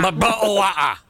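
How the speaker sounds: put-on dropped-T British accent, bottle of water; mock British accent. Teasing the British hosts in a mock British accent. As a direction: lean into a cartoon British accent. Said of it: "mocking British speech".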